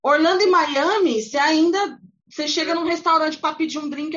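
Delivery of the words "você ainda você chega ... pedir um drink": ordinary talk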